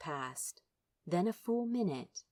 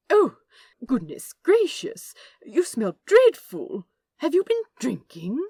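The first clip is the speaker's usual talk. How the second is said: higher, loud, fussy gentleman's dismay; louder, higher prim angel, aghast; prim shocked angel; 8 semitones higher, 10 dB louder than usual. Acted. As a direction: recoil in loud, fussy horror at the stink. Aziraphale appalled by Crowley's smell.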